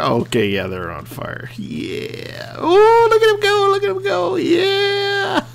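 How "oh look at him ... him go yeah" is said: very high-pitched excited whoop; thrilled squeal. Real, not acted. Player watches his machine tear off, thrilled squeal. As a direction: squeal with delight as it takes off.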